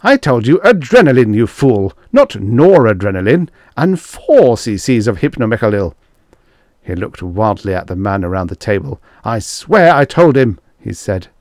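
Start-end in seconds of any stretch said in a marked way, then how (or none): 0.0-5.9 loud, exasperated scolding; angry correction. A narrator's reading. Stark scolding the doctor over the wrong drug, angrily. 9.2-10.6 loud, high insistence; desperate protest. A narrator's reading. Stark insisting to the men that he told him, wildly.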